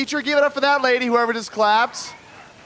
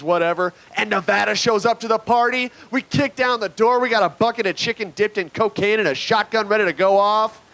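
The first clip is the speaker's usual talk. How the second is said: normal talking voice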